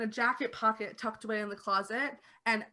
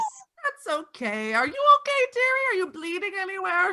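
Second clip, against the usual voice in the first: much higher, louder granny fussing; doting old lady; 10 semitones higher, 5 dB louder than usual. Acted. Old lady fusses over the vampire who broke her glass. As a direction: coo over the guest.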